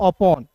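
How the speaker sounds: अपॉन with an open "au" sound for the O. Shown as a demonstration. Teacher showing students how to read upon, अपॉन with O as "au". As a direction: say अपॉन as "uh-PAWN". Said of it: "ओ का उच्चारण औ के रूप में करोगे".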